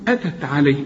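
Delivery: عليه stopped on a still h; pausal form. Shown as a demonstration. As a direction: end أتت عليه with no vowel after h. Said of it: "إذا وقفت تقول".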